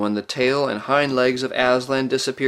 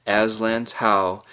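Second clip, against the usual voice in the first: usual speaking voice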